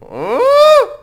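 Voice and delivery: high squealing ooh; loud squeal. Shown as a demonstration. Testing the mic with a loud squeal.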